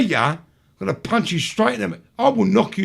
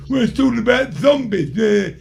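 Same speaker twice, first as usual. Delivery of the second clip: mocking the troll. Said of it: "come onto my live feed and go".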